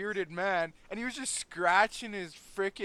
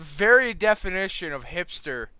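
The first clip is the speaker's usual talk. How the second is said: much louder mocking jab; loud teasing accusation; 3 semitones higher, 13 dB louder than usual. Real, not acted. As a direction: call it out loudly, drawing out the start. Loudly teasing the vinyl buyer.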